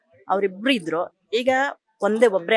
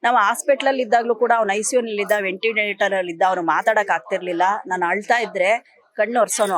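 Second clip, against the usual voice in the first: ordinary talk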